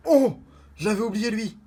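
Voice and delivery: high, delighted-sounding exclamation of surprise; surprised. Real, not acted. Card opener discovering a forgotten card, high surprised exclamation. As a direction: react to a card you had forgotten.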